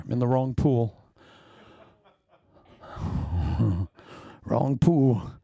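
ordinary talk, nothing marked

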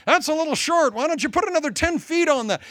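high, teasing egging-on; mock encouragement. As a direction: goad the enemy to build it taller. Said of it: "In fact, encourage 'em".

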